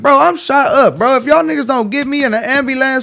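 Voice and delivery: loud, high pleading shout; desperate yell. Retold or imitated. To the police who ignore his wound, a loud high desperate yell. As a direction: yell it like you're bleeding out. Shot man yelling at police.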